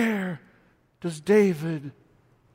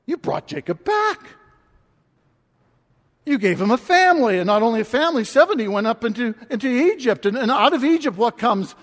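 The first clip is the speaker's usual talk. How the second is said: fast, loud, rising cry of praise; building to a near-shout of wonder; voicing David's thrilled praise to God; excited praise; 9 semitones higher, 12 dB louder than usual. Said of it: "and he would have".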